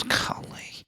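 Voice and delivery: very soft, voiceless mock exasperation; exasperated whisper. Husband imitating wife's reaction to his sarcasm, exasperated whisper. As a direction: breathe it out in a whisper, imitating her.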